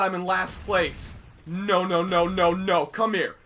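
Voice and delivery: usual speaking voice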